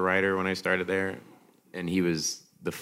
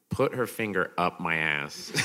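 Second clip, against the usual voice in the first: ordinary talk, nothing marked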